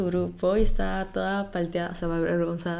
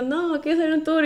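own protesting voice; 8 semitones higher than usual. Retold or imitated. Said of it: "Así que yo".

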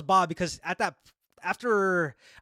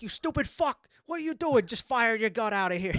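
high-pitched indignant cursing, lightly acted; retold tirade with a hint of Pacino; mock outrage, voice pitched up; Scarface-like outburst; 5 semitones higher than usual. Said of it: "little bit of a, of Scarface came out".

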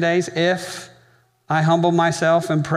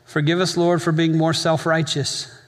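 closing prayer. Acted. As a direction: pray over the congregation with long pauses. Leads the congregation in prayer.

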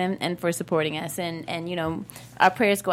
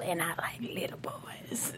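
whispered, sinister aside; creepy whisper; 4 semitones lower, 15 dB quieter than usual. Retold or imitated. Playing the villain for the cohost, a creepy whisper. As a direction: breathe it out like a lurking creep. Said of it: "this is my Theodore Bagwell".

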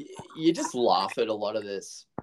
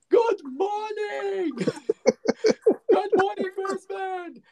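much higher, laughing call out; mock Akeem greeting; 5 dB louder, slower than usual. Shouting greeting to Brisbane from doorstep, laughing. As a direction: sing it out from the doorstep. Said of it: "standing out on your front doorstep going".

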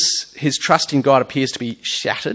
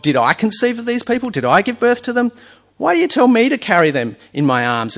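voiced Moses; 9 semitones higher, 4 dB louder than usual. Voicing Moses, higher.

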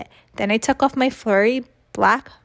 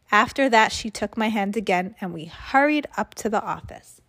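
usual speaking voice

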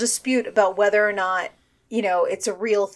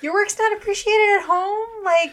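high, disbelieving question; mock incredulous; 9 semitones higher than usual. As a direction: gush like a starstruck fan. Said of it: "I would be like".

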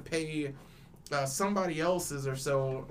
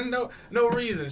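laughing; 6 semitones higher, 8 dB louder than usual. Real, not acted. Laughing repeat of the punchline.

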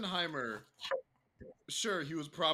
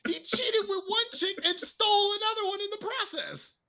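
much higher, animated exclamation; excited. Real, not acted. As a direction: pile on the outrage excitedly. Excited agreement.

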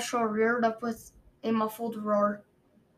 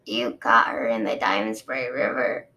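deep male dragon voice; 12 semitones lower than usual. Acted. Osprey voiced low.

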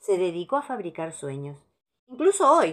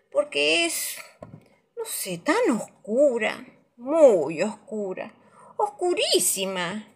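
spooky storyteller; 6 semitones higher, slower than usual. A narrator's reading. Dark night, slowed.

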